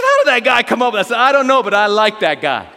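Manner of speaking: loud, high-pitched retold exclamations; animated mock astonishment; excited retelling. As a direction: relive the amazed exchange for laughs. Retold delighted exchange after joke.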